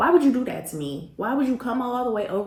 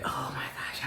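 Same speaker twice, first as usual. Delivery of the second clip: dreading whisper; 6 semitones lower, 9 dB quieter than usual. Retold or imitated. Dreading the incoming call.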